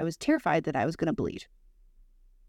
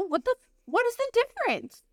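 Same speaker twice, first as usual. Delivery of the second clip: baffled. Retold or imitated. Confused retold reply to Nana.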